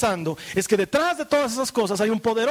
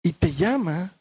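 tender sermon close; 7 semitones lower than usual. Acted. Tender closing preaching.

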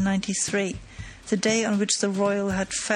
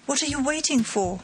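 impatient cry; 5 semitones higher than usual. A narrator's reading. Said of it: "they cried".

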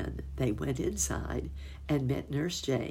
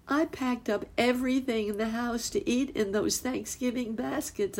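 much higher, apologetic muskrat lady; fretful housekeeper; 9 semitones higher than usual. Acted. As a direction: fuss and apologise in a high anxious voice. Nurse Jane's voice held.

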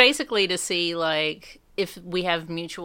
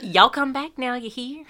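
hillbilly catchphrase; 6 semitones higher, 4 dB louder than usual. Retold or imitated. Signing off the podcast with a mock Beverly Hillbillies goodbye. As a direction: sing out a folksy Southern farewell. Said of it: "That's from the Beverly Hillbillies".

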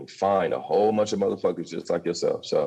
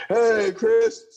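room greeting; 5 dB louder than usual. Retold or imitated. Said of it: "as soon as they said".

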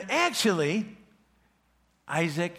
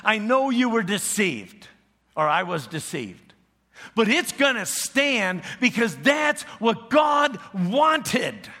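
Isaac telling his son the blessing stands; firm loud declaration; 6 semitones higher, 6 dB louder than usual. Retold or imitated. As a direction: proclaim it as final, raising the voice. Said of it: "He said".

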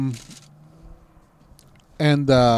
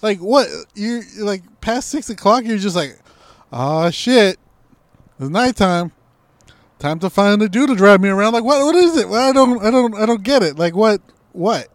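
higher and louder, worked-up rant; exasperated rant; 6 semitones higher, 5 dB louder than usual. Real, not acted. Ranting to his co-host about his dates, loud and fed up. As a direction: vent loudly, fed up.

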